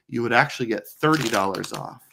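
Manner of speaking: usual speaking voice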